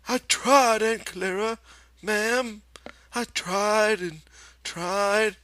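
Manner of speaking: put-on very high, loud, pleading childlike voice; a scolded child begging forgiveness; pleading Lenny. A narrator's reading. Lenny pleads with Aunt Clara, very high and childlike. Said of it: "she interrupted him".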